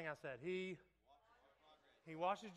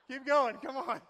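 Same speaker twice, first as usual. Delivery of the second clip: loud, higher urging to a lifter; spotter's shout; 5 semitones higher, 12 dB louder than usual. Shown as a demonstration. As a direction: push them through the rep, loudly.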